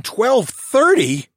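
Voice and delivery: slow, loud, high shocked question; shocked gasp. A narrator's reading. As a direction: gasp it in disbelief. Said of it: "I gasped".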